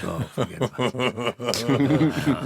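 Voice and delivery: laughing it off, slow. Real, not acted. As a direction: laugh through a dismissive line. Laughs at the question.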